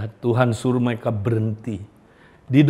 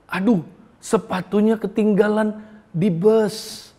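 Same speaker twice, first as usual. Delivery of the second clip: put-on a higher, startled "Aduh" as the boy remembers; a child's sudden dismay, voiced lightly; oh no, the shoes are gone; dismayed gasp; 7 semitones higher than usual. Child realizes shoes are lost.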